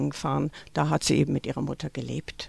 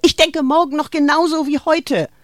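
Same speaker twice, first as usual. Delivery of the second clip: high, loud shout after her; called after; 10 semitones higher, 6 dB louder than usual. A narrator's reading. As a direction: hurl it after someone leaving. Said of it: "rief Greta ihr hinterher".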